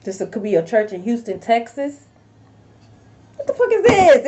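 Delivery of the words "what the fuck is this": shocked. Real, not acted. Reacting to a tarot card.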